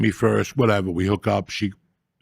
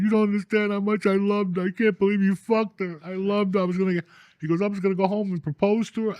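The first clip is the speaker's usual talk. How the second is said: higher, mock-tearful pleading; imitating a crying guy; 9 semitones higher than usual. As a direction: play the heartbroken fiancé, whiny and wounded. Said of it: "This kid started crying".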